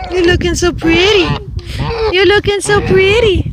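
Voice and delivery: high praising pet voice. Real, not acted. Praising the goose.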